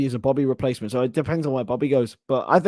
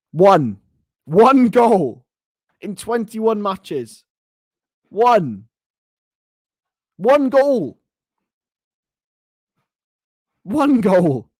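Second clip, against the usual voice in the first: louder, higher hammering of the single goal; mock outraged shout; 6 semitones higher, 8 dB louder, much slower than usual. Real, not acted. Streamer to chat, mocking one goal in twenty-one matches with a scornful shout. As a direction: pound the number home in scorn.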